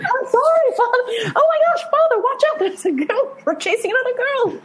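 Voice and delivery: high, excited daughter voice; girlish panic. Acted. Daughter apologises to her father then panics at the chase. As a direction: squeal it like a flustered girl.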